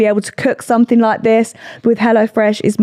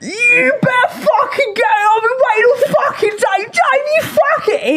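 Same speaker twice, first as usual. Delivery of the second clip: mock furious shout; slower than usual. Retelling a friend yelling at Dave all day, hammed-up shout. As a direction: yell his name like you're fed up waiting.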